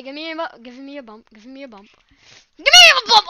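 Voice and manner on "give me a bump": excited shout. Real, not acted. Racing game excitement.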